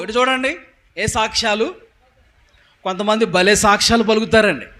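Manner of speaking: very loud, forceful sermon proclamation; booming, emphatic preacher; full-force preaching. Real, not acted. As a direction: thunder the commandment at the congregation.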